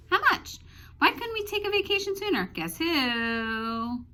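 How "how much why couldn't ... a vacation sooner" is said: much higher, fast questioning; eager questioner. Acted. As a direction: pepper out the questions. Question mark postcard.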